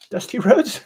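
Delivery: a quick high, mock wrestler's voice on the name; Dusty Rhodes voice. Sculptor mocking the wrestler's own voice, high and put-on. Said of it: "He sounded like Divine".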